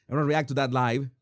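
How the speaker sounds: normal talking voice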